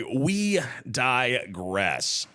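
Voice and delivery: very slow, stretched-out words; mock-ponderous, elongated delivery; drawn-out aside. Real, not acted. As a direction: draw every syllable right out. Mock-grand aside on drifting off topic.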